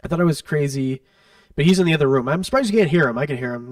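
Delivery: ordinary talk, nothing marked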